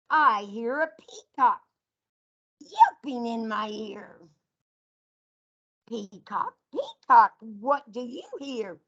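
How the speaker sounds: sing-song picture book read to a class; reading to children. Acted. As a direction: read it out like storytime for little kids. Teacher reads animal picture book.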